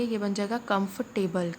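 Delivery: usual speaking voice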